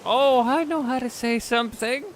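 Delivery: put-on high, eager old lady chirp; grandmother voice. Grandmother eager to show the doctor, in a high chirpy voice. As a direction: play the proud grandma showing off. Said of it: "she was like".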